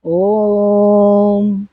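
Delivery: "ओम" chanted on a low note. Shown as a demonstration. Teacher shows Om on three notes. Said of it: "तीन स्वरा".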